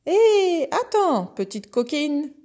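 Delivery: high, loud horse shout; whinnying horse. A narrator's reading. Horse launching after the little goat, whinnying shout. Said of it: "En hennissant".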